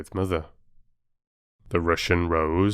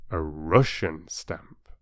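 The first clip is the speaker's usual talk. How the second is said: ordinary delivery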